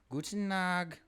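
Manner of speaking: German accent. Shown as a demonstration. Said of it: "I do know how to do a German".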